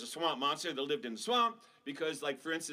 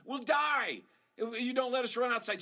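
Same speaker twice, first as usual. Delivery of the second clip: put-on beg, then burst out like a kid; desperate kids; 8 semitones higher than usual. Kids begging mom, ending in a high shout.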